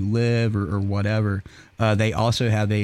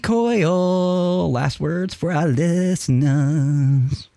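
slow, drawn-out host announcement, pitched up; announcer call; 7 semitones higher, much slower than usual. Acted.

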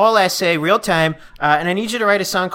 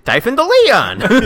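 character intro; 6 semitones higher, 4 dB louder, slower than usual. Acted. Introduces his character name.

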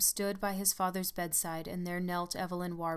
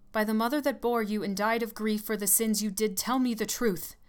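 louder, rising demand; old nurse demanding the truth from the dying man; commanding; 4 semitones higher, 7 dB louder than usual. A narrator's reading.

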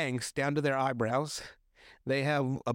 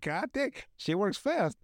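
amazed; 7 semitones higher than usual. Retold or imitated. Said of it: "I'm like".

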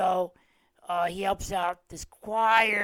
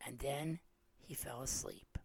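softer, hushed storybook ending; bedtime fade; 5 semitones lower, 13 dB quieter than usual. A narrator's reading. Host closing the bear story for viewers, softer and hushed. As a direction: let the story drift off to sleep.

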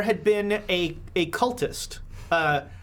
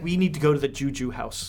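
lower-pitched imitation of calm insistence; 6 semitones lower than usual. Game master echoing a player who ignores the guy, very calmly. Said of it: "like, very calmly".

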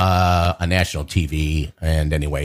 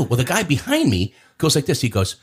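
usual speaking voice

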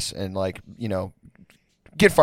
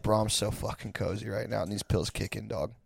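much softer, lazy drugged murmur; stoned cozy mumble; 4 semitones lower, 12 dB quieter than usual. Retold or imitated. Stoned roommate refusing to leave couch, soft lazy mumble. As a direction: sink into the blankets and mumble. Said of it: "he was like".

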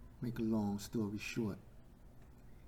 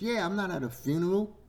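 high, loud comeback; defiant retort; 9 semitones higher, 7 dB louder, faster than usual. Acted. Loud man answering church people who hush him, defiant. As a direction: fire back at the shushers.

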